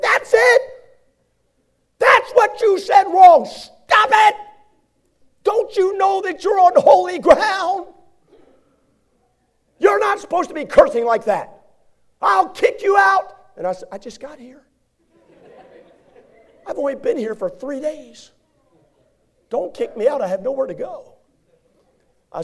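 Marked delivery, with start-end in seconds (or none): 0.0-13.3 high, loud, slow screaming rage; furious professor. Retold or imitated. Professor screaming at the student for cursing again. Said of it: "He came running out". 13.9-21.1 high, pleading voice; pleading student. Retold or imitated. New student pleading with the professor not to be expelled.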